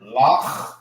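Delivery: Lach alone with a rasped final kh; syllable demo. Shown as a demonstration. Teacher breaking down the word, rasped Lach. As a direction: draw out the throaty ch of Lach. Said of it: "You have the".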